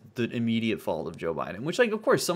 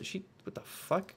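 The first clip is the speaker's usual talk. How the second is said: usual speaking voice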